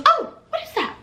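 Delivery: high, fading voice of a nosy customer; chatty wife. Retold or imitated. The chatty wife asks about the necklace, high and nosy. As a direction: ask it high and sweet, trailing off.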